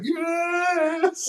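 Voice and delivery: high, far-off triumphant yes; crowd cheer. Retold or imitated. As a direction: cheer like a whole arena. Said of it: "we all collectively were like".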